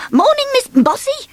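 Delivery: high, bouncy greeting; cheery Mister Bounce. Acted. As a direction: call out brightly while bouncing past.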